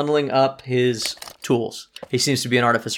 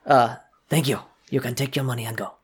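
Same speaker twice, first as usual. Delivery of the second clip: curt guard dismissing, faster at the end; a firm guard, lightly voiced; strong voice. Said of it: "strong-sounding voice goes".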